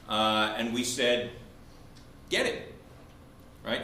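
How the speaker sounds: usual speaking voice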